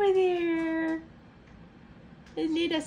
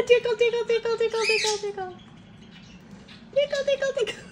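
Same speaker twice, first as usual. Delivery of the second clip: talking to a dog; 4 semitones higher, much faster than usual. Real, not acted. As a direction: sing it high, teasing and playful. Tickling pet, baby talk.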